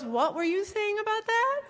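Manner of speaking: high-pitched mock mom; oblivious question. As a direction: ask innocently, having missed it all. Oblivious mom asks after all that.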